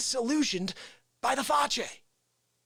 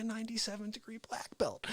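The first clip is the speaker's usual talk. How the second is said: whisper; 9 dB quieter than usual. Acted. Hushed aside.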